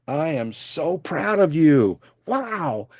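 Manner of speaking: slow, lifted, heartfelt praise; retold slow proud praise to a student. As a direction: linger on how proud you are.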